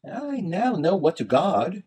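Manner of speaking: high-pitched minstrel with a sigh. A narrator's reading. Minstrel answers the traveller's warning, high and sighing. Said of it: "the minstrel sighed".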